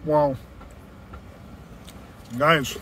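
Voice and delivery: talking between chews; chewing food. Real, not acted. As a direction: mouth partly full. Seen 0: Bites pizza at -12; cheeks and closed lips working through 3.3.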